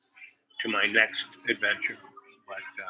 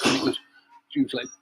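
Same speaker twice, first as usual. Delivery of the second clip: ordinary delivery, nothing marked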